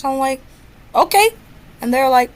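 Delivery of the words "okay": high, loud questioning okay, retold; mock-innocent okay with a sharp upward lift; cheeky okay. Student answering the office staff about cameras, cheeky. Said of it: "You do not use that tone".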